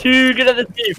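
loud, high hype praise; excited shout. Real, not acted. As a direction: cheer it out. Excited praise after a good play.

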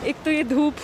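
muffled behind cloth mask; speaking while walking masked; through a face mask. Real, not acted. Seen 3: Cloth mask covers mouth and nose throughout; reference frames unmasked.

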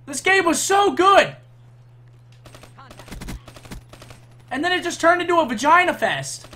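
high loud outraged rant; frustrated yelling. Real, not acted. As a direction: rant at the top of your voice. Raging streamer yelling at game.

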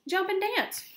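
high, excited rooster call; urgent animal shout; rooster's cry. A narrator's reading. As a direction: crow out the advice, pitched high. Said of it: "cried the rooster".

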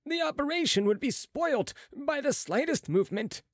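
high-pitched, insistent protest; remonstrating. A narrator's reading. Head surgeon fears for the operation, high remonstrance. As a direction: lift the voice to warn against it. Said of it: "remonstrated the head surgeon".

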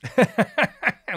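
loud open laugh. Real, not acted. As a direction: burst of real laughter. Joke about earning less.

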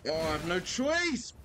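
high-pitched wail, rising; desperate gamer yelp; real panicked cry. Real, not acted. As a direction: cry out as you're cornered. Chased by an enemy in game.

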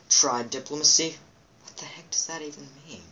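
normal talking voice

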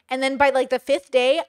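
ordinary delivery

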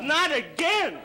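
loud, high, exasperated yell; exasperated shout. Acted. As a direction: blow up at him in mock fury. Harvey yells at Sonny in sketch.

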